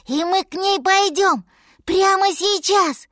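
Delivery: put-on high, loud childlike excitement; eager little mammoth. A narrator's reading. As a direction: ask eagerly as a baby animal.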